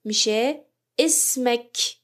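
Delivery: اسمک said slowly, "ismak"; slow Arabic demo. Shown as a demonstration. Teacher modelling اسمک as "ismak" for students, slow.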